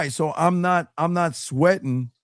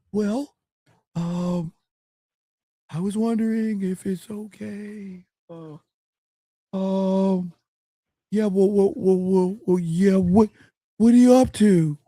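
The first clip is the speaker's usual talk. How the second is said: nervous guy. Retold or imitated. Mocking a nervous guy asking a woman out, halting. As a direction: stammer like a timid guy working up nerve.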